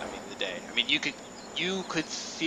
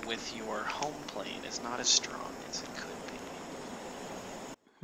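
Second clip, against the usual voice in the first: hushed; 9 dB quieter than usual. Acted. Game master hushes the roll result.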